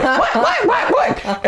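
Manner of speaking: very high squawked whats; clucking like chickens. Retold or imitated. Mark squawking back at the boss, chicken-like panic. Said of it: "just clucked at each other like chickens".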